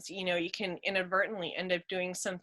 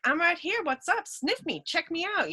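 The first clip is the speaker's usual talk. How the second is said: high, eager, pushy little cat voice; a cat pushing into the dog's face, lightly voiced; 9 semitones higher than usual. Curious cat greeting the new dog, light high voice. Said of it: "And he's like".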